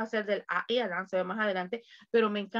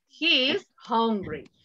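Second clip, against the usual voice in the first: slow model answer; slower than usual. Shown as a demonstration. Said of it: "él tiene hambre".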